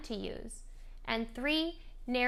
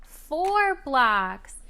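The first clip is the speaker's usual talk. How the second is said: excited parentese; 3 semitones higher, 6 dB louder, much slower than usual. Shown as a demonstration. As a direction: cheer the toddler's tower along. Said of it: "you could comment on what they're doing".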